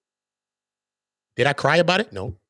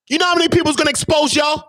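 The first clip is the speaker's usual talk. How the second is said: ranting shout; 10 semitones higher than usual. Real, not acted. Ranting at critics.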